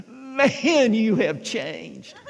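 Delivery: high, slow words breaking into laughter; laughing, out of breath. Retold or imitated. Old friend teasing the pastor while laughing. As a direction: gasp it out while still laughing. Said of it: "finally she caught her breath".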